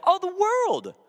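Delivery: high, loud swoon on "world"; gushing mock awe. Preacher mocks a common reading, gushing high. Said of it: "we tend to think like".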